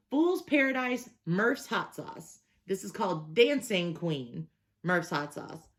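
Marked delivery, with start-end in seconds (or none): none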